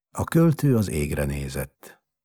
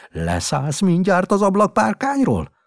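angry; 14 semitones higher, 7 dB louder than usual. Acted. Father yells at son on ledge.

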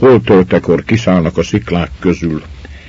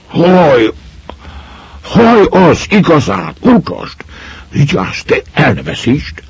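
excited; 10 semitones higher than usual. Acted. Lads excited by golden hair.

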